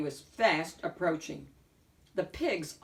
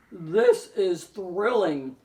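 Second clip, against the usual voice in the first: very slow, louder, drawn-out delight; thrilled wolf; 8 dB louder, much slower than usual. A narrator's reading. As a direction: savour every word, spellbound. Wolf gripped by the play.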